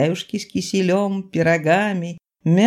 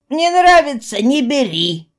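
short, loud brush-off; curt refusal; 7 semitones higher, 5 dB louder than usual. Acted. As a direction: cut her off bluntly. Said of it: "кратко отнекивался Толстый".

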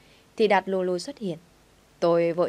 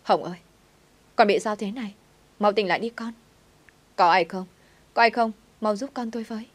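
raised, louder cry for help, acted; narrated panicked scream; 4 semitones higher, 4 dB louder than usual. A narrator's reading. Aunt finds her child collapsed, acted panicked cries for help. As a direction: call out urgently as a frightened mother, short of a full scream. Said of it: "thím tôi hét toáng lên".

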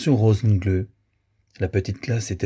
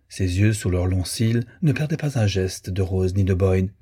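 plain reading voice

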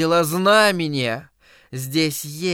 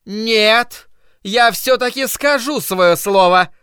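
higher, louder defiant cry; shouting; 8 semitones higher, 5 dB louder, slower than usual. Acted. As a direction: shout them down and insist. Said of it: "прокричала миссис Кармоди".

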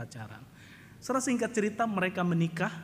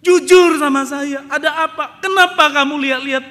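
loud, high tearful accusation; wife shrieking at her husband; crying wife; 11 semitones higher, 16 dB louder than usual. Retold or imitated. Wife confronting husband over the marriage papers, shrill and loud. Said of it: "dia terus bolak-balik".

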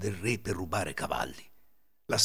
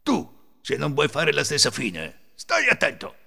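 loud, raised warning; stern warning; 4 semitones higher, 5 dB louder than usual. A narrator's reading. Thief threatening the remaining companion, stern warning. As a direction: warn him off sharply. Ladro avverte l'altro.

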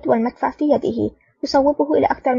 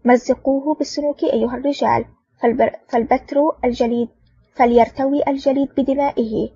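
ordinary delivery, nothing marked

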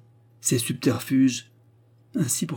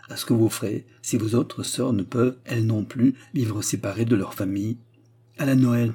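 ordinary delivery

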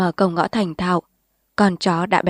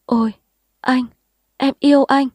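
high, louder delighted cry; moaning with delight; 6 semitones higher, 4 dB louder than usual. Acted. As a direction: gasp it out with joy. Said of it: "rên lên sung sướng".

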